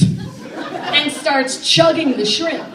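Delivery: high and slow, laughing through it. Real, not acted. Telling the audience, slow and laughing. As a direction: stretch it out amused.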